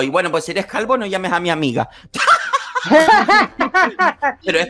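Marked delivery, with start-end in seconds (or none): 2.1-4.4 high, loud laughing fit; high burst of laughter. Real, not acted. Laughing at own joke.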